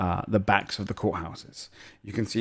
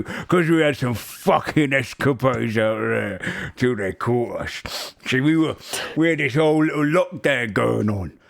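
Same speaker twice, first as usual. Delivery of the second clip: boastful ogre. Acted. Ogre telling old acquaintance about escapades, boastful character voice. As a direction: spin the tale in a rough-and-ready ogre character.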